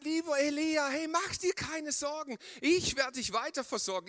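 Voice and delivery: sarcastic high-pitched imitation of God's promise; mocking God. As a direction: parrot God as if he were a clown. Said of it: "Brüller Gott, du bist so lustig".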